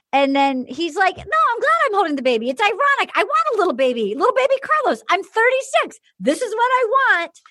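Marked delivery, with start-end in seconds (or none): 1.2-7.4 high, eager mock protest; pitched-up, quick and insistent; mock earnest insistence. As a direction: imitate a man gushing defensively. Acting out Ed's insistence about babies.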